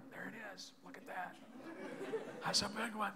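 hushed hunting-video whisper, barely voiced; imitating a whisper. Preacher imitating the hunting-video guy's whisper. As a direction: breathe it like a hunter hiding in the brush. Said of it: "Guy's whispering the whole time".

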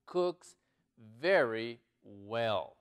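very slow, word by word; slow model reading. Shown as a demonstration. Teacher modelling an English sentence for learners, very slowly. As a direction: draw out each word with clear gaps.

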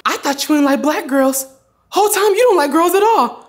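high-pitched mock accusation; imitated indignant mom. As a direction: challenge the child like a put-out mother. Mom's accusing question acted out.

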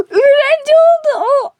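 high, gasping disbelief; gasp, then blurt it out high and stunned; shocked gasp. Real, not acted. Vlogger shocked that his friend Ali became the teacher, gasping high.

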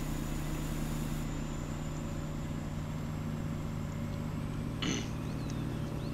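long throat clear; drawn-out clearing of the throat. Real, not acted. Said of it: "I've been clearing my throat trying to avoid coughing".

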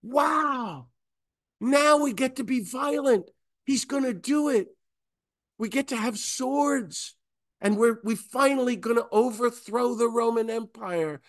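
high, thrilled voice of eager disciples. Retold or imitated. Disciples cheering to Jesus, high and thrilled. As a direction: play the disciples giddy at finally getting swords. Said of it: "the disciples are like".

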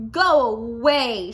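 slow, loud grouchy shout; grouchy ladybug shouting at the friendly one. A narrator's reading. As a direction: bark it away, drawn out. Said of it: "shouted the grouchy ladybug".

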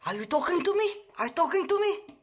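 high mock tough-guy English; movie gangster line. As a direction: ham up the famous movie line.

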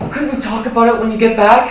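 loud, high-pitched begging question; desperate plea. Acted. Roommate pleading as the other leaves, desperate. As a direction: call urgently after someone leaving.

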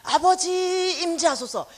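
imitating a congregation crying out in worship; mock worship cry. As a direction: lift it up like a fervent worshipper. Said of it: "손을 높이 들고".

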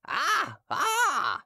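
very high mocking "Ah, ah!"; drunken hawker's "ah". Hawker spotting Derues in the crowd, high mocking cry. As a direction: crow with recognition.

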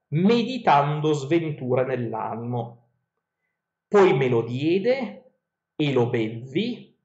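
slow, measured reading of ancient verse; epic verse reading. Acted. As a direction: read the verses like a storyteller. Reads Odyssey verses aloud.

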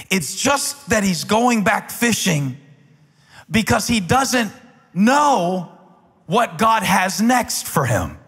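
high, loud, fervent preaching climax; preaching at full force. Acted. Pastor rallies the congregation with fervent full-force preaching. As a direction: build the sermon to a fiery peak.

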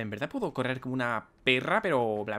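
ordinary talk, nothing marked

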